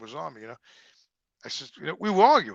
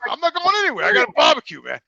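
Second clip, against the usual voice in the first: high, louder mock-triumphant boast; 12 semitones higher, 10 dB louder than usual. Husband boasting he's staying because of the barbecue, mock-triumphant. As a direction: crow it like you won.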